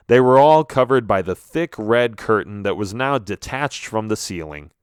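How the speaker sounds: ordinary delivery, nothing marked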